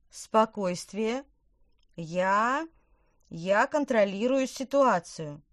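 loud, slow, trembling false calm; shaky voice. A narrator's reading. The scared witch assures everyone she's in control, trembling. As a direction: fake confidence with a quaking voice. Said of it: "дрожащим голосом".

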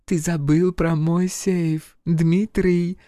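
high, slow, purring tease; purring femme fatale. Acted. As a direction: purr it at him. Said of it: "мурлыкнула француженка".

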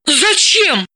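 high, startled question; astonished. Acted. Dalila startled by her friend's order, asks in surprise. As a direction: caught off guard by the pool plan. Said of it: "поразилась Далила".

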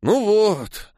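drawn-out, disappointed sigh of words; upset. A narrator's reading. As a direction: say it with mild dismay. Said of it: "расстроенно поморщился Андрюха".

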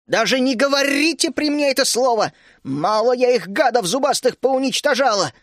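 high, loud furious outburst; angry shout. A narrator's reading. Teresa shouting at the guests who said dragon, furious. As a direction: yell it, banging the table in rage. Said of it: "закричала Тереза".

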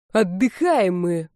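slow, measured call to a deaf listener; understated yell, words drawn out; mild mock yell. A narrator's reading. Rafael to his deaf grandmother, slow mild yell. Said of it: "проорал глуховатой родственнице Рафаэль".

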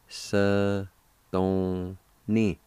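"Sa ... don ... ni", each syllable held apart. Shown as a demonstration. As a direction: sound out "Sa, don, ni" slowly. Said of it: "is pronounced like this".

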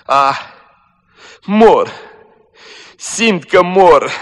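high, slow moaning cry. Acted. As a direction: moan it out like someone dying. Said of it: "spunea gemând".

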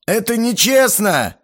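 high, slow, hurt whine; offended wail. A narrator's reading. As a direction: wail it like a sulking kid. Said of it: "обиженно взвыл Боба".

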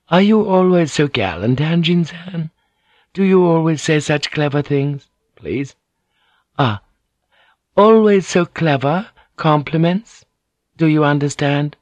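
high, coaxing noble lady; lady Ochiba. A narrator's reading. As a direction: persuade the general sweetly. Ochiba flirts with Blackthorn.

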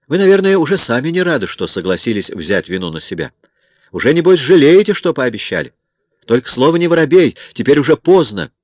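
high, loud mocking jabs; taunting woman. A narrator's reading. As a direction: she needles him that it is too late. Woman character voiced, taunting. (насмешливая женщина)

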